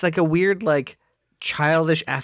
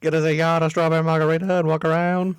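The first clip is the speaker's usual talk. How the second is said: mock New Orleans accent; faster than usual. Co-host topping the riff, mock New Orleans accent. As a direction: rattle it off in a Southern accent.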